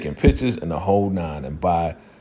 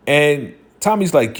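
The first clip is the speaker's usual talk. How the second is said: usual speaking voice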